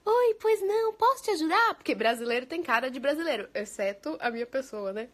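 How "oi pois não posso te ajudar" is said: high, sing-song customer-service greeting; high-pitched, helpful sales clerk; Brazilian shop attendant. Retold or imitated. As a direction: imitate an eager shop assistant. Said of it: "tem uma atendente que é brasileira".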